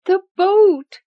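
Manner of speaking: loud high straining cry. Acted. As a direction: strain to call out. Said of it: "she cried loudly".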